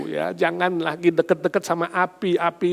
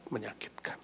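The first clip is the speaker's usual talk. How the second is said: breathy whisper of Menyakitkan; 5 semitones lower, 19 dB quieter than usual. Acted. Pastor echoing the hurt to the congregation, whispered. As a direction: let Menyakitkan fall to a whisper.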